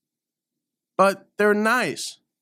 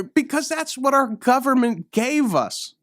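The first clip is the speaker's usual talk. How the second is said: ordinary talk, nothing marked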